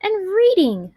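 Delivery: put-on teacher cheering up the children, lifted; happy list. A narrator's reading. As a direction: sing out each treat brightly. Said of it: "said Missus McBee".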